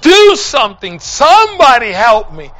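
high, agonized yell for help. Retold or imitated. Dying John screaming that his feet burn, desperate yelling. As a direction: yell at the top of your voice. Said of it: "starts yelling".

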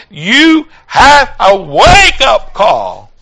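put-on fiery preacher calling out to young people; preaching at full force. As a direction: drive the message home to the pews. Preaching to the congregation.

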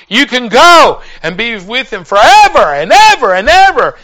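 high, loud, swelling desperate appeal; desperate plea. Retold or imitated. Preacher begging dying John to turn to the Lord, desperate. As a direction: plead loudly, leaning on each repetition. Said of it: "I was desperate".

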